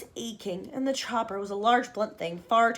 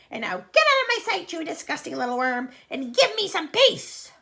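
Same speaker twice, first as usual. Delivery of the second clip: much higher and louder angry order; furious scolding; 6 semitones higher, 5 dB louder than usual. Acted. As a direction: drive the worm out of your sight. Aunt scolds boy.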